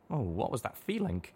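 ordinary delivery, nothing marked

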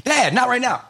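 put-on high, pleading kid protest; a kid begging dad to stop; whiny kid. Son cutting off dad's story, high and whiny. Kids protest in acted scene.

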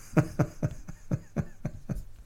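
long, soft run of chuckles; amused laughter. Real, not acted. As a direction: laugh fondly and at length. Amused by daughter's bluntness.

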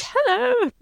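very high, sing-song hello; bright high mock greeting. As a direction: chirp it up like a surprised girl. Sophie looks up at shadow.

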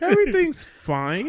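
high forced reassurance; squeaky, badly faked calm; perturbed, faking fine. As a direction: pretend all is well while clearly perturbed. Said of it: "You sound really perturbed by this".

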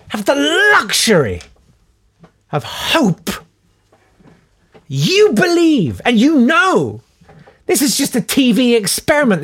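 high, scornful lecturing; scornful rant. Real, not acted. Podcaster ranting at rich TV landlords, scornful and high.

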